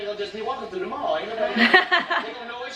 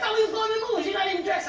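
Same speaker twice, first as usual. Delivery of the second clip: much higher exclaimed protest; mock disbelief; 13 semitones higher, faster than usual. Friend protesting to Michael, much higher pitch. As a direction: push it up high in disbelief. Retelling surprise at disguise.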